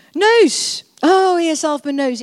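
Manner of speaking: loud prayer call. Acted. Pastor calls out then prays.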